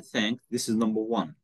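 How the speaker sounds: ordinary talk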